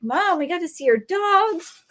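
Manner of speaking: put-on high, eager child's voice; bubbling over like thrilled little boys; excited kids. Boys telling mom about the teacher's dogs, high and eager. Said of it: "They're like".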